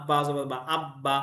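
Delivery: আব্বা said slowly, the ব held double; teacher stretches the doubled ব in আব্বা; doubled consonant. Shown as a demonstration. Teacher showing parents how children read আব্বা, slow and doubled. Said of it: "তাসদিদ ওয়ালা হরফ দুইবার পড়তে হয়".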